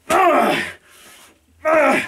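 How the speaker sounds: high, hammy battle roar; shouted growl. Acted. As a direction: growl like a wrestler showing off. Showing he still got it.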